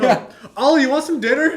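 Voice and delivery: laughing sweet-talk to dog. Retold or imitated. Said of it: "She's like".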